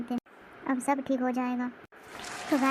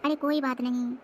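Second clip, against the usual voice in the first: usual speaking voice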